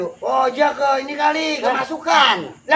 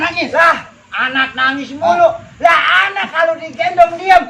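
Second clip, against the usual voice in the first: ordinary talk, nothing marked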